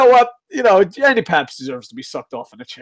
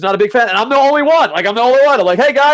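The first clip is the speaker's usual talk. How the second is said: loud protest; 8 semitones higher, 6 dB louder, faster than usual. Real, not acted. Owning an unpopular opinion.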